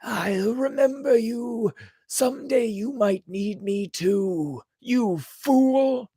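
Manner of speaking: high, bitter threat; spark's parting shout. A narrator's reading. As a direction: spit out a warning. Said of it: "yelled after him".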